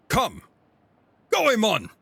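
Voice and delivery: high, loud battle call; full-voiced high command; summoning shout. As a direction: cry out the name like a summons.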